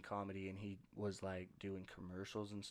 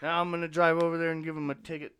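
higher and much louder, a cartoon bird bragging; gleeful bird voice; 8 semitones higher, 13 dB louder, faster than usual. Bird bragging to his buddy about the car, loud and gleeful. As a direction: crow about it like a prankster bird. Voicing birds spying on people.

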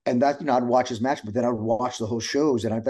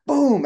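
loud, high "Boom!" for the slap; 6 dB louder than usual. Retold or imitated. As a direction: punch out the impact word. Slap sound in story.

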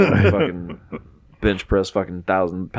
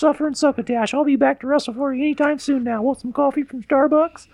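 goofy high voice. Retold or imitated. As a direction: squeak it out like a cartoon character.

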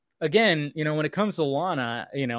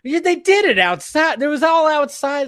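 much higher and louder, worked up; excited outburst; 13 semitones higher, 8 dB louder than usual. Real, not acted. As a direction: blurt it out, worked up about the kiss. Excited rant about public kiss.

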